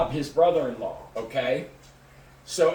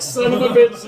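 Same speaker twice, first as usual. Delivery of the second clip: loud, higher mock cursing; retold exasperated outburst; 6 semitones higher, 9 dB louder than usual. Driver realising it means jail, mock exasperated outburst. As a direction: curse loudly as if just caught. Retold reaction to getting caught.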